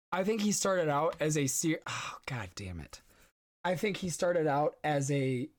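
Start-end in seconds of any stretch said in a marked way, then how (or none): 1.9-3.0 whispered under breath; whispering. Real, not acted. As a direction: whisper it to yourself, annoyed. Flubs line, mutters aside.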